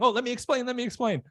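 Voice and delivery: high mock-panicked babbling; nervous pleading. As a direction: act the sweating suspect. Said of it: "they're talking fast".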